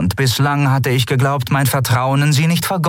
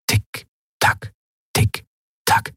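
breathy tick-tock whispered like a clock; slower than usual. A narrator's reading. Clock ticking in his head.

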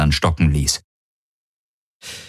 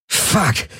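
gasped; 9 semitones higher than usual. Acted. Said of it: "keuchte Galen".

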